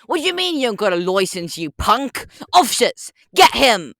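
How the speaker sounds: put-on British accent building to a loud shout; British accent, ordering officers to arrest. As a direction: demand the license, then call the officers. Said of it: "British Walrus Detective".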